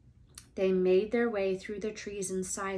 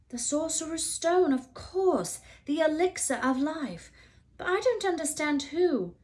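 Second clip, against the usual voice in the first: put-on young Harry; 9 semitones higher, 4 dB louder than usual. A narrator's reading. Harry answering, higher young voice.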